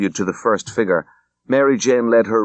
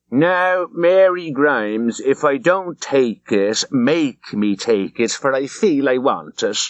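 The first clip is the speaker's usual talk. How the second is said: slow, higher mock low Dublin accent; sidling Dublin accent mimicry, slow and higher; Missus Cassidy in low Dublin accent; 5 semitones higher, slower than usual. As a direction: drawl it out in a put-on low Dublin brogue. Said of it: "he had assumed a very low Dublin accent".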